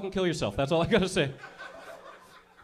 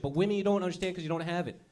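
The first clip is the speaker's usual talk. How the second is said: ordinary talk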